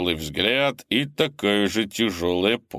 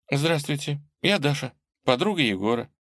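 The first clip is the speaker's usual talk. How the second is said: put-on young girl voice; 6 semitones higher than usual. Young girl introduces herself.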